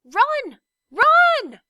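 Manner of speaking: shouted high and very loud; a desperate shout of warning; crying out. A narrator's reading. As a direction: yell a warning to flee. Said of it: "it shouted again".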